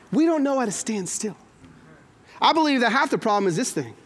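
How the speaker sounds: normal talking voice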